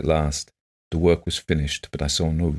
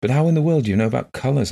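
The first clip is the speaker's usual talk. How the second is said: high, fast, astonished question; exclaiming; 8 semitones higher, 3 dB louder, faster than usual. A narrator's reading. Visitor amazed at blind woman's colours, exclaiming. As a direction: blurt it out in surprise. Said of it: "I exclaimed".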